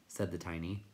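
ordinary delivery

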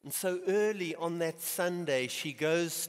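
preacher pouring feeling into the story; fervent preaching. Acted. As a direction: build the story with rising passion. Preaching passage rising in intensity.

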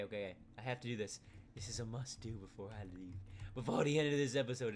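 ordinary talk, nothing marked

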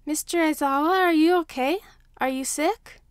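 put-on high, anxious child voice; a small kid fretting over his teacher; worried little boy. A narrator's reading.

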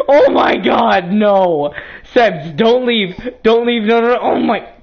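loud desperate begging; pleading shout. Real, not acted. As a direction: beg him loudly not to leave. Begging friend not to leave.

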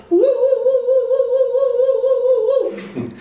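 high whooping police siren noise; siren imitation. Mimicking the cop car siren behind them.

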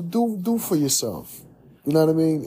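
ordinary talk

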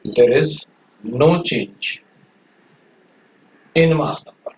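each word drawn out slowly for students to write; teacher slowly dictating the line to the class, drawn out; very slow dictation. Shown as a demonstration. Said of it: "repeating slowly for dictation".

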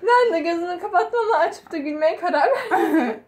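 talking through giggles; high giggly voice; laughing. Real, not acted. Still laughing after failed hold.

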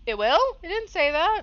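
loud high disbelief; shocked and indignant. Real, not acted. Told ghost will attack her.

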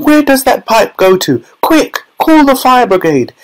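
very high, shrieky cries for help; frantic panic. A narrator's reading. Mother frantically calling for the fire brigade.

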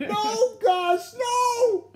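high, loud cry of protest. A narrator's reading. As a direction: shout no up high. Sam cries out.

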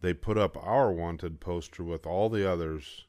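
plain reading voice

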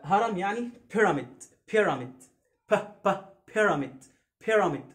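بيراميد said loud with a clear r; teacher drilling pyramid. Shown as a demonstration. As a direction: call out بيراميد crisply. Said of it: "الار يا جماعه ازاي".

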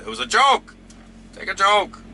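jokey outburst climbing high; mock indignant shout. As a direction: shout it up like you're teasing the critics. Defending a joke to commenters.

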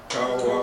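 "Ka wago" said loud, leading into a question; question inflection. Shown as a demonstration. Teacher leading the student's retry, "Ka wago" in emphatic questioning intonation. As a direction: open the question firmly with "Ka wago". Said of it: "inflect your voice".

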